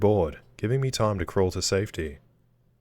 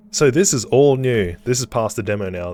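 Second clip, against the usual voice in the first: loud, higher burst, easing off; excited; 3 semitones higher, 8 dB louder than usual. Real, not acted. Player tells viewers the game is past the demo, excited. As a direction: announce it loudly with real excitement. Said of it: "This is exciting".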